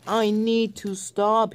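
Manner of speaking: begging to stop as he rolls out of control; desperate cry. Acted. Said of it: "he cried".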